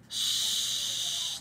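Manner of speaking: voiceless soothing shush. Acted. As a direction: hush a crying child gently. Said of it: "Leslie said, stroking Maybelle's head".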